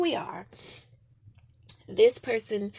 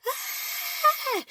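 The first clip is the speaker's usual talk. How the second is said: high piercing mock scream, a touch loud; 3 dB louder than usual. Girl imitates her own scream at the bully. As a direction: let out a high childish scream. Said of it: "I would literally start to scream".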